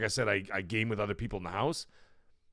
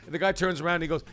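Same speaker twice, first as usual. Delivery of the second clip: ordinary delivery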